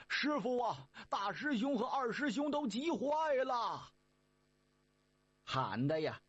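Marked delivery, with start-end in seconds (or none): 0.1-3.9 higher calling voice. Acted. Sandy calling for master, higher calling voice. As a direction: call out anxiously. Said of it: "这三位就喊开了".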